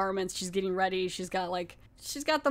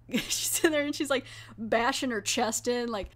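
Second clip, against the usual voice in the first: ordinary delivery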